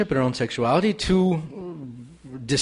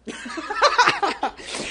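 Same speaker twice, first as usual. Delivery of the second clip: high laugh. Real, not acted. Laughs at own joke.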